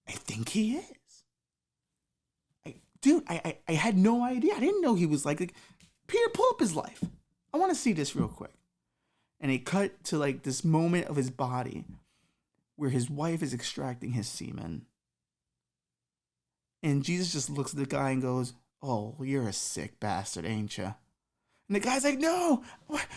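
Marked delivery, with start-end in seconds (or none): 0.1-1.2 high, breathless whisper; whispered shock. Acted. Peter confirms in shock. 2.7-8.5 stammer, then snap an order; Jesus demanding to see the guy's life; shocked Jesus. Acted. 18.8-20.9 lower, softer, slow sneer; Jesus condemning the guy; disgusted Jesus. Acted.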